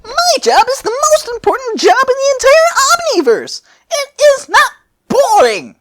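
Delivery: very high, loud squeaky protest; indignant Cronoa. Acted. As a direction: squeal it out, offended. Cronoa insists her job matters.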